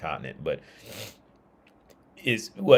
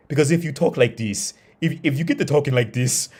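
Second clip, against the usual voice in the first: a put-on, higher sneering voice, lightly done; mock local voice; 6 semitones higher than usual. Local mocking an American's dialect, put-on sneer. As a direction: imitate a snooty local judging your dialect.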